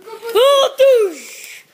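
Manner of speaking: high, loud yelp and shot noise; imitated gunshot. Soldier startled in a pretend battle, loud gunshot noise. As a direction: cry out as you fire the play gun.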